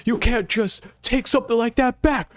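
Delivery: high, alarmed voice of a panicking giant; scared underling voice, pitched far up; frightened giant. Acted. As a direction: squeak out the shock at the demon. Giant protesting to Chief Dory.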